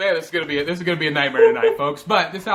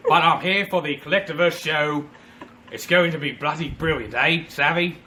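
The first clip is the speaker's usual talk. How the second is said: Captain Jack Sparrow. Acted. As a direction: play the cheeky rum-soaked pirate captain. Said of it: "It's actually Captain Jack Sparrow".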